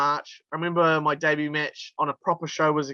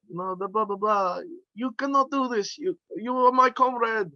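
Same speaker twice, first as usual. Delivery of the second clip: hammed-up Russian villain accent, pitched higher; mock Russian heel taunting in a pitched-up Russian accent; school-prank Russian accent, raised high; 6 semitones higher than usual. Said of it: "I'm just doing this accent".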